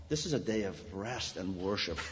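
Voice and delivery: put-on soft, low Latvian-accented "day of rest and worship"; light Latvian accent. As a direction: imitate the Latvian friend, low and quiet. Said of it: "He had a thick Latvian accent".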